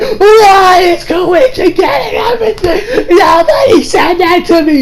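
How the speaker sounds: high, slow, crying whine; hammed-up jealous bawling; mock sobbing. Okuyasu cries that nobody ever confesses to him, sobbing high. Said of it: "starts bursting into tears".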